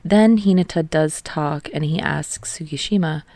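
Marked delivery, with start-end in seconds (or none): none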